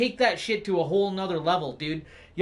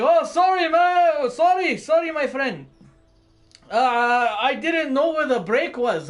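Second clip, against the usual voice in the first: much higher, louder put-on voice for the sorry trucker; mock trucker apology; 6 semitones higher, 6 dB louder than usual. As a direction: play the trucker sheepishly apologizing.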